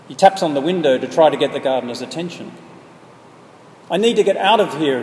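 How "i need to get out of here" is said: mild acted scream, a touch higher and louder. A narrator's reading. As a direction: call out for rescue, not full force. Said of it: "he screams".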